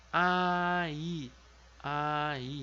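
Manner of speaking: ai as two separate vowels, one beat each; separated a-i. Shown as a demonstration. Teacher modelling the word for child, separated. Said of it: "Então fica".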